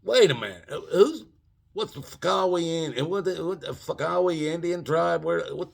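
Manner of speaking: lost and scrambling for answers; hammed-up bewilderment; confused. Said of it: "I'm going".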